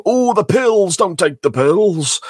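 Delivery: high, loud, slow mock lament; preachy anti-drug voice. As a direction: wail it like a moral lesson. Mocking anti-drug episode.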